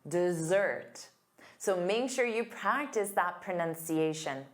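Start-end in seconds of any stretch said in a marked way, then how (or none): none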